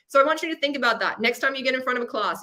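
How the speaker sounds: ordinary talk, nothing marked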